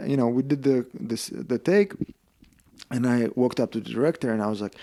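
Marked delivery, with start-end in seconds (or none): none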